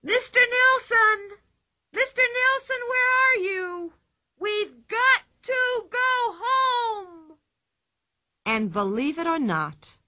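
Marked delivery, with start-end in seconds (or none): 0.0-7.4 very high, loud, drawn-out calling; Pippi, top-of-voice shrieking. A narrator's reading. Pippi calling her lost monkey, shrieking loudly. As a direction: holler it high across the woods. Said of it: "shrieking at the top of her voice".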